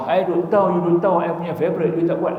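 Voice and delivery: high-pitched, quick scolding of a wife; mildly hammed-up indignation; mock angry husband. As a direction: act out a worked-up complaint, pitched well up. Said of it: "Allah, marah".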